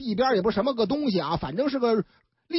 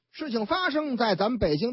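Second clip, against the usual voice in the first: ordinary talk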